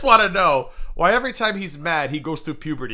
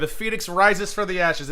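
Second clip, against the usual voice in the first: normal talking voice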